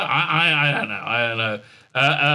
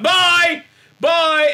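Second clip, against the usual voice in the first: high, loud sing-out; hammed-up calling goodbye; mock cheery farewell; 14 semitones higher, 7 dB louder than usual. Writers waving off Tilly, mock farewell called high.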